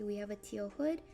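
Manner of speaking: ordinary talk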